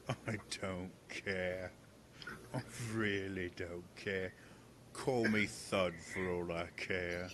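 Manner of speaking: low, soft, slow resignation; a dejected guard giving up; dejected mumble. Acted. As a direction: sulk it out quietly. Thud gives up.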